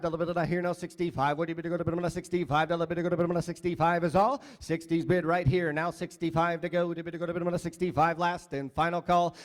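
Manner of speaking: fast rhythmic auction chant; auctioneer. Acted. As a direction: rattle off the bids in a singsong. Second item auction chant.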